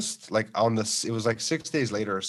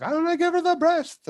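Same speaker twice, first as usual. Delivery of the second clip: mock whiny wife.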